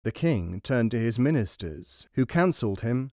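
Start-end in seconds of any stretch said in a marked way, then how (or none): none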